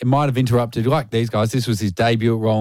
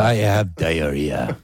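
slow, lower mock French accent; 5 semitones lower, slower than usual. Host repeats the joke in mock French, slow. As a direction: say it slowly like a French lover. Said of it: "It all sounds good in a French accent".

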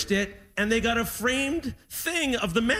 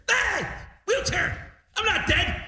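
put-on much higher, outraged old-man squawk; mock indignant dad; 11 semitones higher, slower than usual. Dad refusing the airport wheelchair, high outraged squawk. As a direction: squawk it like an offended father.

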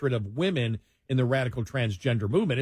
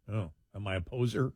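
softer, lower muttered self-question; self-doubting aside; 5 semitones lower, 7 dB quieter than usual. Retold or imitated. Host questioning himself after trying out y'all, quiet self-doubt. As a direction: drop your voice as if privately doubting yourself. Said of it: "threw out a couple of y'alls and went".

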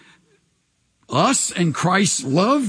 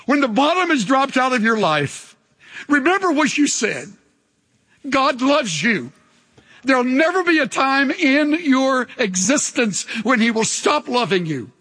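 higher, earnest comfort; tender reassurance; 6 semitones higher than usual. Acted. Pastor comforting a hurting congregation, tender earnest voice. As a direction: reassure someone in their darkest hour.

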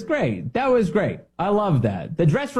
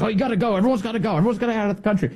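raised-pitch, repetitive imitation; hammed-up higher-pitched insistence; mock insistent quote; 7 semitones higher, much faster than usual. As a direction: imitate someone frantically repeating orders. Acts out Mullin's repeated posts.

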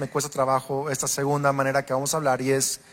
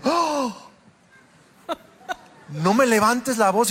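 mock complaint; 6 semitones higher than usual. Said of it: "para aquel era como".

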